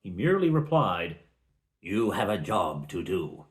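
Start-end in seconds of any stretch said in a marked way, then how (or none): 1.8-3.4 low, measured wizard reply; creepy wizard. Acted. Wizard cryptically answering the party's questions, creepy. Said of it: "not creepy or anything".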